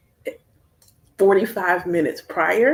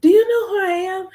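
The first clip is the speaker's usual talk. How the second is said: much higher, louder indignation; indignant challenge; 12 semitones higher, 6 dB louder than usual. Retold or imitated. Dream self confronting someone who was slick, much higher and louder. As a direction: demand respect, affronted. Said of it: "I'm like".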